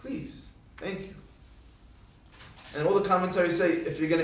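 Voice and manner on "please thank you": very quiet, slow, courteous words; soft politeness. Shown as a demonstration. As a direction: say it meekly, almost under the breath.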